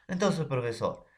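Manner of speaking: usual speaking voice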